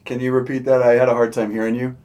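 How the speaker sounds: loud teasing; mock can't hear. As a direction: rub it in loudly. Teasing after rival team named.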